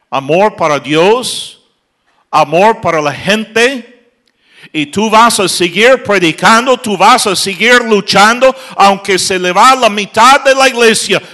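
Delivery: rally the pastors, voice at full force; fiery preacher urging on; preaching at full force. Acted.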